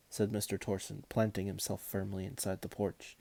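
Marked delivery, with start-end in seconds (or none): none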